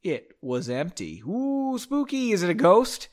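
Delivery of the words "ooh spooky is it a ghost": high, teasing ghostly ooh; mock spooky. Host teasing the empty aisle scene, mock-spooky high voice. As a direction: play at being spooked in a raised voice.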